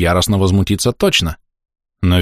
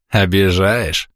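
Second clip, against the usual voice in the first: slow, drawn-out smug reply; smirking, mock offended; much slower than usual. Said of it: "Лума ухмыляется".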